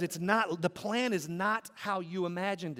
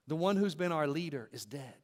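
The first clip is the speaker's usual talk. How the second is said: normal talking voice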